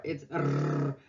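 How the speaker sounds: R said at the front of the mouth, tongue forward; Russian front R. Shown as a demonstration. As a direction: say R rolled at the front. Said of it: "bring it to the front".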